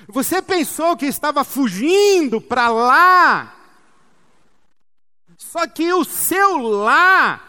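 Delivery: loud, high, commanding preacher's voice for the Almighty; Deus. Acted. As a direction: proclaim it slowly, punching and stretching key words. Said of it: "Essa pergunta de Deus pra Elias".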